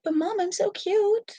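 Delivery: very high cutesy dog voice; squeaky begging puppy voice; voicing the dog. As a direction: beg like an adorable pup. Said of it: "She's like".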